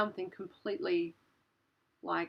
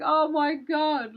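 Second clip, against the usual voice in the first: louder, higher, drawn-out exclamation; retold alarm; 7 semitones higher, 9 dB louder, slower than usual. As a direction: relive the shock of the owl. Owl flies straight at the windscreen.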